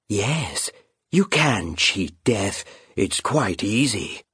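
slow, coaxing, eerie whisper-like voice; sly phantom bird. Acted. Phantom bird luring the raven into a bite, slow and sly. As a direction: lure him in slowly and coldly.

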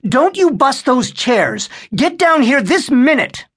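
put-on mother scolding kids in the attic. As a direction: snap the order up the stairs.

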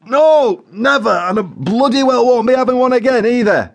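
high, loud "No, never" refusal; Joe Windass, emphatic. A narrator's reading. Joe swears off the curry, loud high emphatic "never". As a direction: hit "never" and "bloody well" with indignant force. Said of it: "he emphasised".

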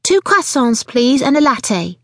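high-pitched, lilting order; chirrupy. A narrator's reading. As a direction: trill the order out slowly at the end. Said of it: "chirruped the woman with the buggy".

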